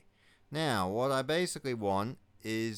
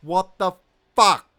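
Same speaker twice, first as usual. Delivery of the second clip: higher and louder, raging at the screen; furious yelling; 8 semitones higher, 10 dB louder than usual. Real, not acted. Gamer furious at his own misclick, yelling at the screen. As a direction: swear it out in full disbelieving fury. Player raging at lost units.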